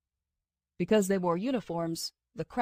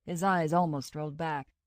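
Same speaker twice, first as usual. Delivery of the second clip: usual speaking voice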